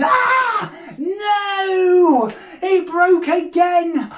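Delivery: high wailing cry, words stretched out; mock despair. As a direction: wail theatrically for the children. Wand breaks again, mock despair.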